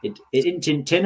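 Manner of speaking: broad Yorkshire, "isn't in tin" run together; Yorkshire meme. Retold or imitated. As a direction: chant it in thick Yorkshire dialect. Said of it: "the latest Yorkshire meme going round".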